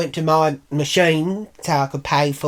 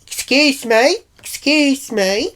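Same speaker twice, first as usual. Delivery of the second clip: high, drawn-out call for attention; mock officious sing-out; 10 semitones higher, 4 dB louder, slower than usual. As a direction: imitate a shop worker hailing someone from behind. Shop worker calls out after her.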